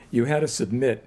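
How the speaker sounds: ordinary talk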